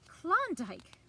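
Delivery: loud, high exclamation; exclaiming. A narrator's reading. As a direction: announce it with gusto. Exclaimed name opens character.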